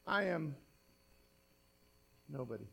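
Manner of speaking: hushed, dropping confession; soft, low admission with a long pause; humbled. Acted. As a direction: let it sink to almost nothing. Acts Isaiah humbled before God.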